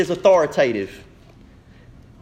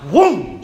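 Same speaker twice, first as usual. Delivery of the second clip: swat sound effect; 4 semitones higher, 6 dB louder than usual. Acted. Swat sound in lion story.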